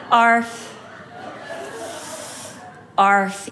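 plainly spoken, monotone "arf"; deadpan dog bark. Retold or imitated. Bored Chihuahua looking at the visitor, deadpan. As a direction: imitate a dog barely bothering to bark. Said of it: "it really sounded like".

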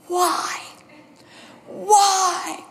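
high, imitated wail of protest; distraught mom. Mom questioning her daughter about the move, distraught. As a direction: plead it high, as an upset mother. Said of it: "my mom just screaming".

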